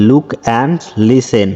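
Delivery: very slow, careful English reading; slow read-aloud. Shown as a demonstration. Teacher reading the textbook instruction slowly to pupils. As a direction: spell it out slowly for the class.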